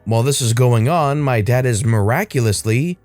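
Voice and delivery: ordinary delivery, nothing marked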